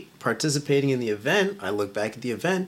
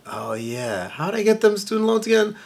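mock realization; 5 semitones higher, 7 dB louder, slower than usual. Mock waking up dreading loans.

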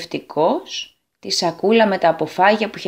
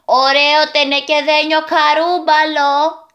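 put-on higher, slow teasing boy voice; mocking boy's call; 6 semitones higher, 3 dB louder, slower than usual. A narrator's reading. Second boy mocks the young man's tin bump, teasing. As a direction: tease him like a cheeky kid. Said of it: "φώναξε το άλλο αγόρι".

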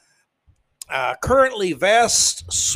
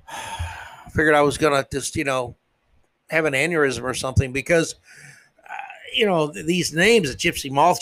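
ordinary delivery

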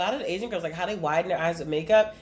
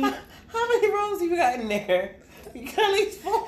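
high, laughing, teasing question; 11 semitones higher than usual. Real, not acted. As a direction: squeal it through laughter. Teasing about many teeth.

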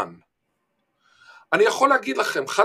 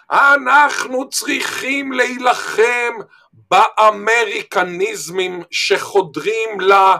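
forceful speech; 5 semitones higher, much slower than usual. Retold or imitated. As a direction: declaim it like a prime minister. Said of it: "והוא אמר ביפנית".

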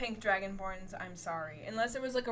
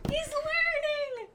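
proud gushing voice; 5 dB louder, much slower than usual. Acted. Player voicing proud character moment.

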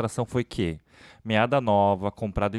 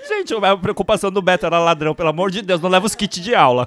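laughing; 6 semitones higher, 9 dB louder, faster than usual. Real, not acted. Jokes about the bus robbery.

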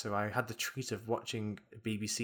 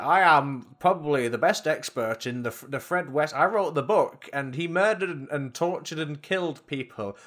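pompous expert; 5 semitones higher, 7 dB louder than usual. Retold or imitated. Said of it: "some guy who's like".

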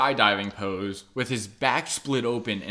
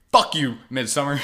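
angry outburst; 3 semitones higher, 6 dB louder than usual. Real, not acted. Ending her angry rant review.

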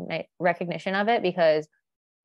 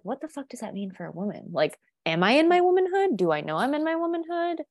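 bewildered questioning; 6 semitones higher than usual. Retold or imitated. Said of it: "and I'm like".

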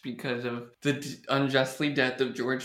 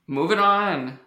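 loud announcement; 6 semitones higher, 6 dB louder, slower than usual. Real, not acted. Host switching segments.

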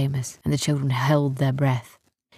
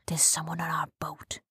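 soft, near-whispered warning; hushed warning; 9 dB quieter than usual. A narrator's reading. Captain Seamus warns the others in hiding, hushed. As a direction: drop to a secretive hush.

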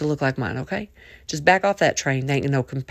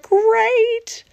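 exuberant; 6 dB louder than usual. Acted. Third great at the peak.

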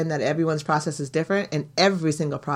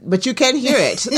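upbeat sign-off plug; podcast outro plug; 5 semitones higher than usual. Acted. Host asks listeners to follow and donate, as an upbeat outro. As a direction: sell the podcast warmly.

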